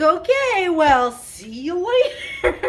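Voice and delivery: high, loud, nervous laughing "okay, see you later"; a cornered man laughing his way out of a conversation; awkward brush-off. Retold or imitated. Josh laughing nervously as he tries to escape the fan.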